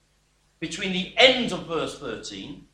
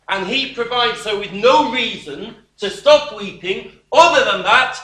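rising emphasis; 4 semitones higher, 9 dB louder than usual. Real, not acted. Preacher presses the point.

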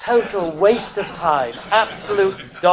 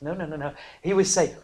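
ordinary talk, nothing marked

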